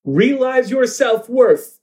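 high, drawn-out proclamation without much volume; mock yell. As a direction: declaim it as a broadcast to the crowd. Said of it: "the question yells".

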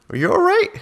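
high worried "are you all right"; concerned voice. Retold or imitated. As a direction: mimic a startled, concerned stranger. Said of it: "everyone goes".

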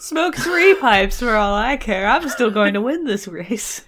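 the king shrugs it off, boldly; forceful king. Acted. King voiced more forcefully.

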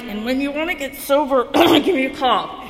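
mock offer. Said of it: "and I'm like".

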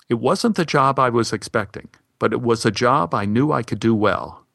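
usual speaking voice

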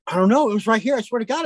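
insistent protest. Retold or imitated. Passenger swears he saw the coyote, insistent. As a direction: plead your case as the retold passenger.

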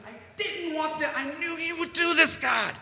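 high, whining prophet griping at God; whiny Jonah. Retold or imitated. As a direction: whine at God like a sulking child. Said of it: "he goes".

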